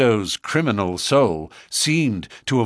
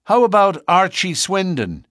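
raised question; 10 semitones higher, 5 dB louder than usual. A narrator's reading. Brother asks.

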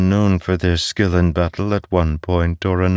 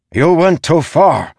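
loud, high-pitched reprimand; called from behind; 12 semitones higher, 6 dB louder than usual. A narrator's reading. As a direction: call out an angry accusation from a distance. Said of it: "the general called from behind".

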